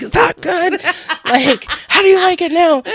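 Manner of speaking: high, loud, laughing taunt; mock taunt while laughing. Wife imagining taunting husband over burger, laughing. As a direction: cackle out the jeer. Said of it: "be like".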